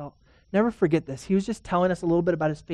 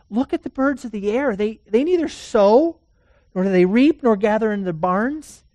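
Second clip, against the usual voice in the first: rising, louder pulpit voice, building; fervent preaching; 4 semitones higher, 7 dB louder than usual. Acted. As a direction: preach it out with growing fire. Preaching scripture at full force.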